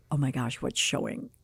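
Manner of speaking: low, hushed worried thought; inner panic. Retold or imitated. Student fearing her secret shows, hushed. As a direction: think it to yourself in alarm.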